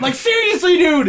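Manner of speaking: high, loud insistence; excited urging. Retold or imitated. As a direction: beg him to come see. Urging friend in retold call.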